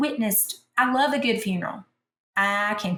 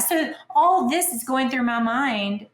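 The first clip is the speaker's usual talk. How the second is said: ordinary talk, nothing marked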